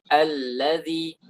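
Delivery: "allazi" slow, the ll long and pressed; held doubled l. Shown as a demonstration. Teacher modelling the right reading slowly, stressed "allazi". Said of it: "seperti itu".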